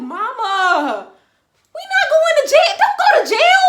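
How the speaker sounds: loud, high frantic holler; retold panic, rising into a shout; panicked pleading. Daughter begging mama not to get arrested, panicked shouting. Teller begging mother to stop.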